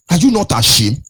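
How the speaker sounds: normal talking voice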